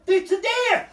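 high, loud retold holler; imitating a startled loud call; excited shout. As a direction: yell it out across the woods. Retold yell about the deer.

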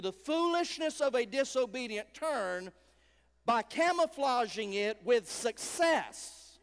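loud, high, driving sermon voice; rising preacher's fervor, pushing every line; full-force preaching. Acted. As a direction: preach it hard to the whole church.

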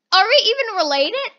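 loud, high outraged question; hammed-up sibling indignation; acted indignation. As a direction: raise pitch and volume in mock outrage. Acted quarrel between siblings.

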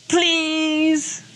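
high pleading daughter voice; a child's whiny please. Retold or imitated. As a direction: beg like a little girl. Daughter begging mom for dress.

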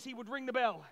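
usual speaking voice

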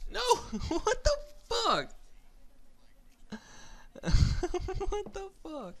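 high, loud disbelieving laughter; shocked laughing. Real, not acted. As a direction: laugh in outrage. Killed for his sniper.

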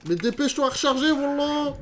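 fast, high and loud urging; rushing someone in a fight; frantic. Real, not acted. Presse de recharger en combat. (pressé et paniqué)

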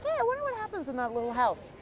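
high-pitched mock-curious passerby; imitating an innocent driver musing aloud; naive wondering. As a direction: put on a high, idly wondering voice. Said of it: "And you're like".

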